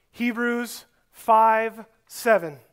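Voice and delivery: slow, careful reference read for note-taking; slow dictation. Shown as a demonstration. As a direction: spell it out slowly so they can write it down. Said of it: "You can write down this reference".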